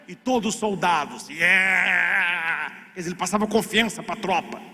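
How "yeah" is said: long, held mock cheer; soldiers' cheer. All the soldiers answer their captain, imitated cheer. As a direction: imitate a troop cheering back.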